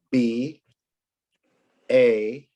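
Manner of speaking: usual speaking voice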